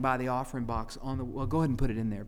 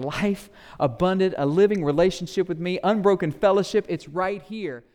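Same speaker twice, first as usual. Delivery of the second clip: eager offer; 4 semitones higher, 7 dB louder than usual. Acted. Jesus offering abundant life.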